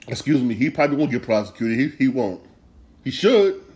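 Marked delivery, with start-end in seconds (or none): 3.0-3.6 much higher and louder, punched hard; angry insistence. Real, not acted. Vlogger demands Brett Favre face prosecution, angry outburst. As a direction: spit it out like a verdict.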